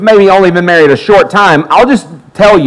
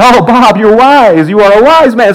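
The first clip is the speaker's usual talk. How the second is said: higher, slightly louder, playfully reverent praise; mock awe; 7 semitones higher, 3 dB louder than usual. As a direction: hail him like a sage who just saved you. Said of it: "I was like".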